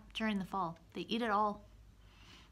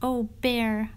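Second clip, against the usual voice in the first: lightly squeaky little mouse voice; a hint of a tiny fretting mouse; 3 semitones higher, 11 dB louder than usual. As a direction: mouse gently scolding Bear for being awake. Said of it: "Mouse squeaks".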